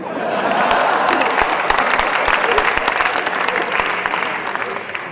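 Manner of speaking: big burst of roaring laughter; the whole room cracks up loudly; loud group laughter. Acted. Jurors laugh at the joke.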